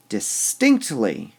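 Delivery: distinctly slowly, with STINCT punched; distinctly drawn out around a strong middle; stress on middle syllable. Shown as a demonstration. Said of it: "We emphasize the distinct part".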